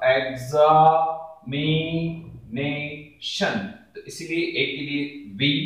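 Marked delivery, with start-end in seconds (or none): 0.0-3.7 एग्जामिनेशन dragged out as एग्जा… मि… ने… शन; syllable by syllable. Shown as a demonstration. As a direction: dictate एग्जामिनेशन in long separate syllables. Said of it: "लिखने का तरीका".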